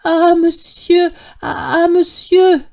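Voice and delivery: high, slow, stammering with emotion; slow, high, overcome old man. A narrator's reading. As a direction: stumble over it, overwhelmed with joy. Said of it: "il bégayait".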